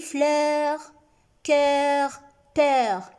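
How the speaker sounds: "fleur, cœur, peur" drawn out on the open eu; open eu sound. Shown as a demonstration. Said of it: "Si je dis".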